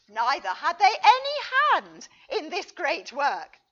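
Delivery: put-on loud, preachy reading of an old letter; declaiming with righteous force; fiery letter reading. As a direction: read it like a zealous sermon, building and loud.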